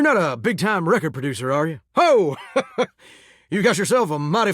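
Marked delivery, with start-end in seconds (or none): 1.9-2.9 hearty "ho" breaking into laughter; amused laugh. Acted. Tickled by the visitor's sense of humor, laughing.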